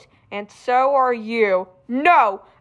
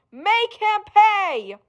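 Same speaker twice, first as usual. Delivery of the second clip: higher, slow drawn-out command; slow higher insistent order; slow rising command; 8 semitones higher, slower than usual. Acted. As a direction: press the demand harder. Sinister voice urging revenge.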